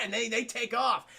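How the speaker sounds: ordinary delivery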